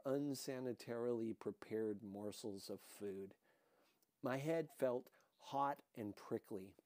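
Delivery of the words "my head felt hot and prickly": usual speaking voice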